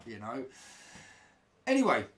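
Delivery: normal talking voice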